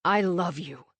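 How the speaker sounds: steadier, firmer and sure; a firm, sincere declaration of love. A narrator's reading. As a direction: say it now like you mean it. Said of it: "saying with more conviction".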